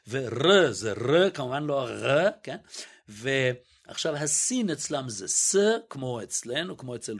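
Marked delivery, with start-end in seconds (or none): 0.1-1.3 ר said slow and louder, rolled back in the throat; slow and louder: ור זה ר, throaty r; guttural resh. Shown as a demonstration. Lecturer drawing out the Yemenite resh, slow throaty demo. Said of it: "אצל הצנענים".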